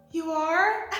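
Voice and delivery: bright, surprised "You are?" with a laugh; a startled, pleased question. Retold or imitated. Adora, surprised by Catra's apology, asking it. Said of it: "Adora goes".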